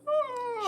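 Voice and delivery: high exasperated ah; exasperated shriek. Acted. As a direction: let out a high fed-up cry. Exasperated noise.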